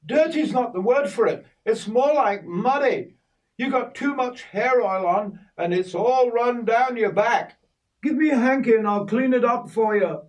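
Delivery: put-on old Kay chiding the boy about hair oil, high voice; voicing Kay. Kay scolds Richard's oily shirt.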